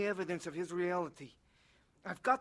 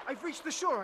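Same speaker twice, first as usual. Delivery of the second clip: higher-pitched burst of relief; lifted, higher voice of discovery; hopeful exclamation; 7 semitones higher than usual. Acted. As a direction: exclaim it as a sudden hopeful thought. Story: swimmer thinks he reached shore.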